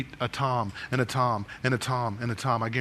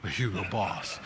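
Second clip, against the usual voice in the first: lightly put-on pompous indignation; a touch of haughty affront; mock pompous; 3 semitones lower, slower than usual. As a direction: imitate a self-important snob taking mild offense. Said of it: "the real pompous ones would, would say".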